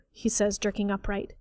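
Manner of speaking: plain reading voice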